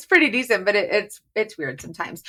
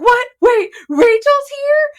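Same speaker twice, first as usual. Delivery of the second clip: much higher and louder, drawn-out surprise; shocked disbelief; 6 dB louder, slower than usual. Retold or imitated. Hosts retell being surprised by a guest, in loud, high disbelief. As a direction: act out being blindsided by a guest. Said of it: "Me and Hannah are like".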